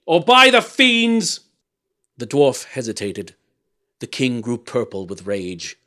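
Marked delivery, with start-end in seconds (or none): 0.1-1.4 high, loud, drawn-out oath; shouted threat. A narrator's reading. Monstrous king threatening the dwarf, shouting. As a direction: thunder the threat slowly. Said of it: "shouted the monster".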